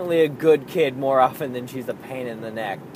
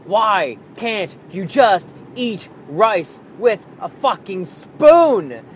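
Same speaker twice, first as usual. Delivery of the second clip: slow, louder exasperated plea; exasperated dad; 5 semitones higher, 6 dB louder, slower than usual. Retold or imitated. Fed-up dad to his daughter about eating rice, exasperated.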